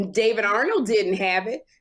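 normal talking voice

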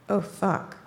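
low, soft, worried little curse; alarmed mutter. Retold or imitated. Teller imitating her date behind her in bed, low worried mutter. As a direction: mutter it like something just went wrong. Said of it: "This is not the right way to say".

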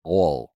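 low, swallowed Southern "Ol". Shown as a demonstration. Demonstrating an Alabama oil, "Ol" low and swallowed. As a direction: swallow "Ol" into one syllable. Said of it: "they swallow it".

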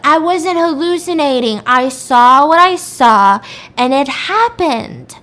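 loud, drawn-out mock protest; acted indignant insistence. Accused woman tells police she saw it, indignant. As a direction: insist loudly, leaning on each word.

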